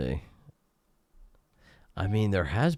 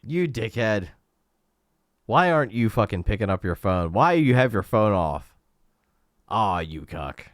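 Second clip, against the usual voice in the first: angry voicemail rant; 4 semitones higher, 8 dB louder than usual. Acted. Yelling into friend's voicemail.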